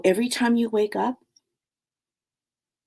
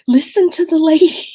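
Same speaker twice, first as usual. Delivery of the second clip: higher and louder, a loving father's urging; dad's voice; 10 semitones higher, 10 dB louder than usual. Retold or imitated. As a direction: play the sweet dad, high and loud. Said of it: "He's like".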